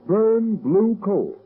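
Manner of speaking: spaced-out announcer punch on Burn Blue Coal; emphatic slogan. Acted. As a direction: drive the brand name home slowly.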